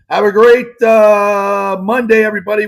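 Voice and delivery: a big cheery goodbye to the listeners; upbeat sign-off. Real, not acted. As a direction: send the audience off with a cheer. Host's upbeat show sign-off.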